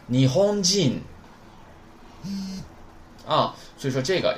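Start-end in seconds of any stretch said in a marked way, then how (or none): 0.1-1.0 你轰进 high and level, falling at the end; correct pronunciation. Shown as a demonstration. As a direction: model 你轰进 clearly as one sustained word. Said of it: "所以说应该读成". (你轰进标准读法，高而平，结尾降调)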